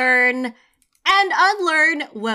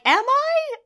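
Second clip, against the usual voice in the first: surprised; 12 semitones higher than usual. Retold or imitated. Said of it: "I'm like".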